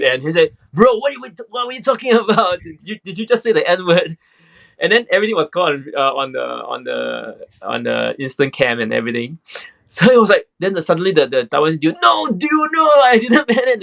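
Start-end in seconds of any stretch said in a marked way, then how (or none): 0.7-4.2 high, fast fake-offended accusation; mock outrage. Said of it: "changed his attitude". 12.0-13.8 much higher, pleading panic; frantic high denial; panicked. Retold or imitated. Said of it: "he panicked".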